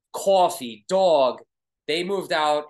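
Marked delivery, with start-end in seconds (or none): 0.1-1.4 coffee and dog in heavy, punched Brooklyn vowels; heavy Brooklyn accent. Shown as a demonstration. Streamer shows viewers the family accent, heavy Brooklyn. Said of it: "That's the heavy".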